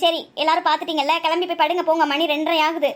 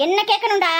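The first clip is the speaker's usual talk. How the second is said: higher-pitched, cross comeback; angry retort; 4 semitones higher, 4 dB louder than usual. Acted. Mother answering her protesting son, angry retort. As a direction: throw the question back angrily. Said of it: "ஏம்மா இவ்ளோ கோவப்படுறீங்க".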